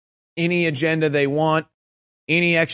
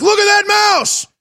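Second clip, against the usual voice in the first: very high, loud and slow alarmed shout; high mock yell; 5 dB louder than usual. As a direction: yell it like a startled crowd. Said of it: "It's like".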